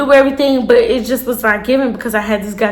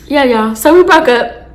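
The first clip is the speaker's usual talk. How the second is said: usual speaking voice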